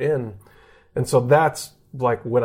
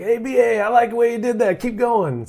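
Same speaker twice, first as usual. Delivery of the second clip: much higher, fast coach voice praising him; college coach; 11 semitones higher, 3 dB louder than usual. Retold or imitated. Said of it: "Even my head coach in college, like".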